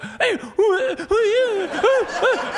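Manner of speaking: very high, yelping excited-dog voice with laughter; squeaky, laughing mock dog yelps. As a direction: play a dog who just heard coyotes and can't contain itself. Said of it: "the dogs are like".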